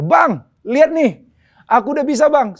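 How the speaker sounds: high, loud, excited hail; imitating a friend's happy shout; retold proud calling. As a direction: call out to show off triumphantly. Friend calls out from motorbike.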